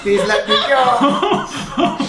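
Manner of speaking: high, slow desperate wail; desperate wailing plea. Acted. Kobold begging, high drawn out.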